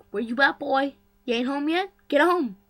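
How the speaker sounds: a lightly voiced loud scolding mother; scolding mom. Mom phoning her son to come home, loud scolding. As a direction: call him home like an annoyed mom. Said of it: "his mom's like".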